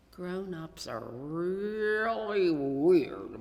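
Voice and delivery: slow, drawn-out amused wonder; bemused thought. A narrator's reading. Boy musing about his laughing parents, bemused. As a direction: muse on it, shaking your head inwardly. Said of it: "Micah thought to himself".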